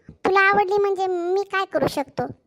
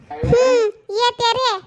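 put-on girl's voice; 6 semitones higher, slower than usual. Girl answers, put-on voice.

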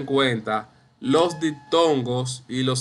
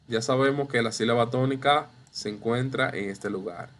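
ordinary delivery

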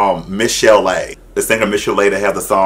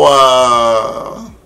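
long, sliding diva "ow!"; imitated singer's "ow!"; 3 semitones higher than usual. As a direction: hold the wail and glide down. Imitating the singer's signature cry.

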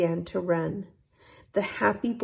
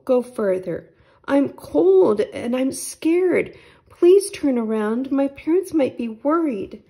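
scared pleading; 5 semitones higher, 7 dB louder than usual. Acted. Scared boy begs to go back.

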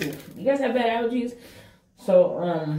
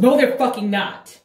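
heated denial; 7 semitones higher, 7 dB louder than usual. Retold or imitated. Denying friends' warning, heated.

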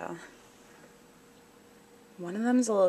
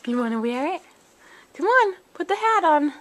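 higher, louder sing-song coaxing; pet coaxing; 9 semitones higher, 6 dB louder than usual. Real, not acted. Owner coaxing her cat to wear a tiny hat, high excited pet voice.

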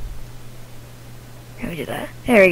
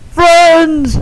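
shouting; 7 semitones higher, 3 dB louder than usual. Acted. Ghost calls out.

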